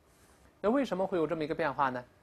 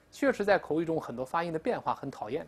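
ordinary talk

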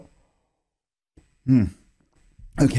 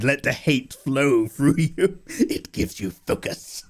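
mock dark side villain; slower than usual. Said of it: "vibing into the dark side".

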